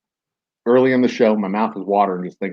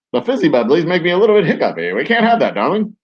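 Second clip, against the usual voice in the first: put-on high, quick old-timey gentleman; 1920s Barnstormer voice; 6 semitones higher, 4 dB louder, faster than usual. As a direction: play a 1920s dandy, higher and quicker. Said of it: "nineteen twenties Barnstormer".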